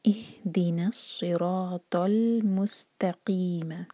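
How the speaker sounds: इहदिना सिरातल मुस्तकीम very slow, ल sounded; recitation demo. Shown as a demonstration. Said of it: "तो पूरा पढ़ेंगे हम". (इहदिना सिरातल मुस्तकीम धीरे-धीरे)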